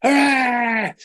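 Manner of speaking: imitated school cheer, high; cheering crowd. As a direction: shout hurrah like a crowd of kids.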